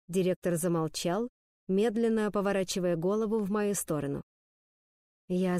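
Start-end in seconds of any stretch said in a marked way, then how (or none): none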